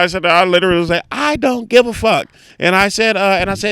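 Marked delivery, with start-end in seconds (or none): 1.1-2.3 high, raised brush-off; exasperated storyteller's retort; retold emphatic dismissal. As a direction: hammer it home, reliving the anger. Repeats own blunt phone reply.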